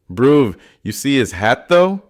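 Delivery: mocking teen, rising high at the end; jeering street teen; taunting teen.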